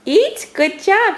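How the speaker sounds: high, eager coaxing; excited high praise. Real, not acted. Coaxing toddler to say word.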